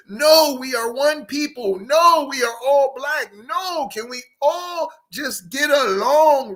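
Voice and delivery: high, pleading mock unity plea. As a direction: whine it like a hurt plea.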